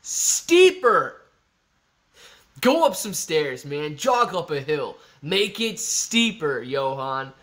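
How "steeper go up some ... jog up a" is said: coaching shout at a walker. Acted. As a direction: bark it up the hill like a coach. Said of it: "what I have to say about your little walk".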